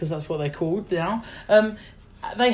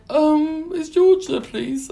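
timid high voice; 8 semitones higher, 3 dB louder, much slower than usual. Retold or imitated. Her own timid question retold.

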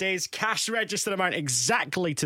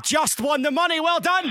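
higher and bright with excitement; excited announcement; 8 semitones higher, 3 dB louder than usual. Real, not acted. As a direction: burst out with the good news. Host announces prize win.

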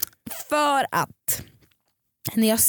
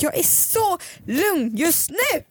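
slow, higher forced calm; mock calm, boiling inside; 6 semitones higher, slower than usual. - a hint of seething fake calm. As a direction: pretend to be calm while seething. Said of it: "Sen så liksom kokar det inuti mig".

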